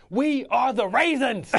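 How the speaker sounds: high, loud, slow mock coach declaration; imitating coach Singletary. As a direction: proclaim it like a proud coach.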